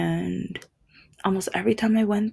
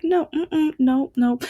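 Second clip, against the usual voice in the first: high, loud insistent no; higher, louder playful refusal; insisting shopkeeper; 9 semitones higher, 4 dB louder than usual. Retold or imitated. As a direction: wave off the protest, pitched high. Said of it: "she was just like".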